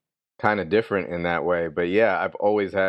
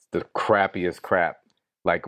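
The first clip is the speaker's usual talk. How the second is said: ordinary delivery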